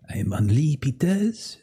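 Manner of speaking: slow, hammed-up French accent; terrible French accent. Game master introducing Henri, terrible French accent. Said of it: "apparently a terrible French accent".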